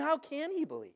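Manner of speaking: ordinary delivery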